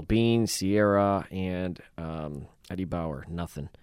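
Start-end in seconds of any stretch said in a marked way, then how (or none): none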